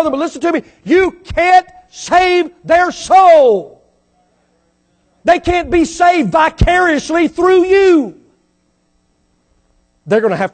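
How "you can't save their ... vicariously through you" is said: slow, loud, higher shout. Real, not acted. Preacher shouting at the congregation that they can't save souls. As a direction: hammer each word home slowly.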